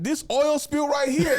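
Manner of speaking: high, loud, slow insistence; emphatic insisting. Acted. Cousin pointing out sister's friend, emphatic insisting. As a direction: hammer it out loudly, laughter close behind.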